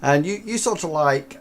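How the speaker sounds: ordinary talk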